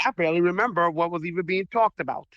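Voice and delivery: ordinary talk, nothing marked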